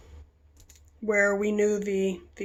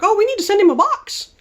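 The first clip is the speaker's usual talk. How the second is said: much higher, louder eager voice; 11 semitones higher, 6 dB louder than usual. Retold or imitated. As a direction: burst out eagerly.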